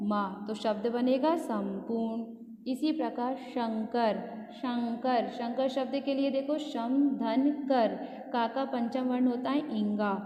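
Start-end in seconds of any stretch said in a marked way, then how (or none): none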